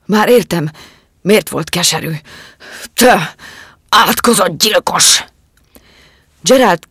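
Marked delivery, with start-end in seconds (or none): 0.1-5.2 loud, rising accusing cry; a poisoned husband turning on his wife; furious accusation. Acted. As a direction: hurl the word murderer at her.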